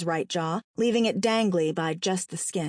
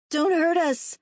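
higher-pitched frightened plea; pleading; 9 semitones higher, 4 dB louder than usual. A narrator's reading. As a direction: plead like a scared boy. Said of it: "Terry pleaded".

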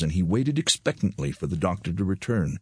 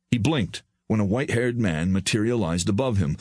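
ordinary delivery, nothing marked

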